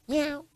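high, soft kitten meow; a lion reduced to a kitten's meow; tiny mock meow. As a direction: squeak out a timid little meow. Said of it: "You'll hear him now meow like no cat".

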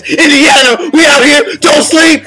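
rally the Chicago crowd; hype shout. Real, not acted. Shouting out Indiana.

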